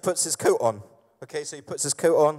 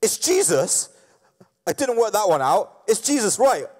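loud, high, hammed-up eureka; excited realisation; 6 semitones higher, 4 dB louder than usual. Peter as people usually read him, excited realisation. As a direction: burst out as if it just clicked. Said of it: "like, he goes".

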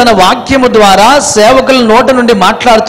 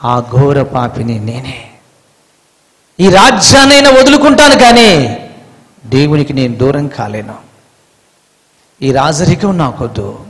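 repentant king; 8 semitones lower than usual. Retold or imitated. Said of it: "లేచి చెబుతున్నాడు".

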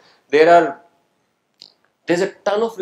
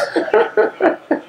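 burst of laughter. Real, not acted. Reacting to a student's remark.

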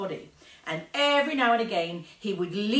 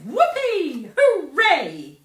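loud, high whoops of joy; cheering; 11 semitones higher, 12 dB louder, much slower than usual. A narrator's reading. Girl whooping for joy over the factory visit, loud and high. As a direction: cheer at the top of your voice.

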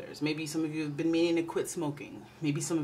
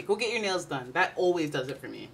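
ordinary talk

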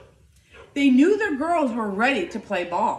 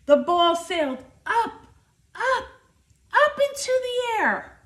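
each up climbs higher in pitch; rising with the ball; 9 semitones higher than usual. A narrator's reading. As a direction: lift the voice as the ball flies.

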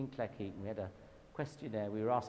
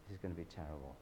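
lower, softer dread; gloomy inner voice; 7 semitones lower, 9 dB quieter, much faster than usual. Retold or imitated. Preacher recalls dreading the mission, low and quiet. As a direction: heart in his boots, muttered low. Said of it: "I thought".

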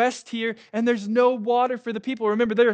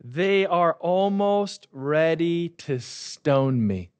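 slow, dramatic read; 3 semitones lower, much slower than usual. Retold or imitated. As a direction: slowly spell out the danger of the stone. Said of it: "Moses said".

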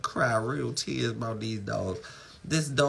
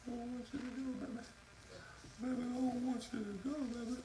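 high, softer hammed-up whine rising higher to the end; mock crying wail; 12 semitones higher, 11 dB quieter, faster than usual. Owner begging the sold dog to stay, high mock sobbing whine. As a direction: beg the dog in a fake-tearful falsetto. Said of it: "I'm gonna cry".

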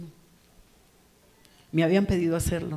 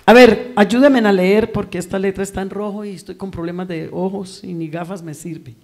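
preaching at full force; 17 dB louder than usual. Acted. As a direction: preach it out to the whole church. Llama a la congregación. (predicación a toda voz, fervorosa)

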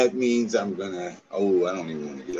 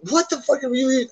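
incredulous; 4 dB louder than usual. Real, not acted. Indignant reply to gossip.

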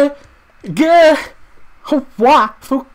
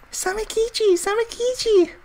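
calling out. Real, not acted. Calling lost friend in dark.

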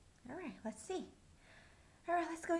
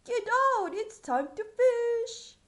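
loud excited duke; 10 semitones higher, 11 dB louder than usual. Acted. Duke calling the king.

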